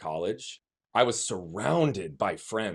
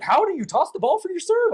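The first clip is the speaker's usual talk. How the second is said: much higher, louder mock amazement; incredulous people; 7 dB louder than usual. Onlookers asking how he serves, high mock disbelief. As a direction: play baffled onlookers, high and astonished. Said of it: "they couldn't compute".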